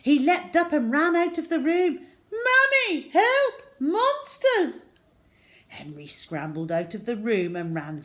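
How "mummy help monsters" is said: high, loud terrified yell for help; a scared boy running for mum; panicked cry. Acted. Steve fleeing in terror.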